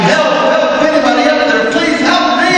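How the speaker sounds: high, loud cries for help; desperate shouting. Retold or imitated. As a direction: yell up the cliff like a man dangling over a drop. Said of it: "and he starts shouting".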